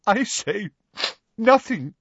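high, choked-up halting words. A narrator's reading. Professor Trelawney holding back to Parvati, choked. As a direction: force it out through angry tears. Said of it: "she choked".

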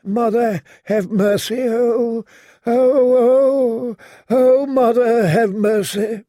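high, moaning plea for mercy; moaning prayer. A narrator's reading. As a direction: cry out to the Mother in pain. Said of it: "he heard Septon Selador say".

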